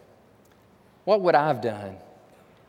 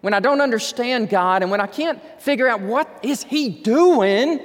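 ordinary delivery

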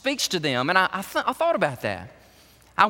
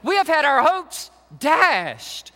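dashed hopes; 8 semitones higher, slower than usual. Retold or imitated. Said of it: "touch their tangible sadness".

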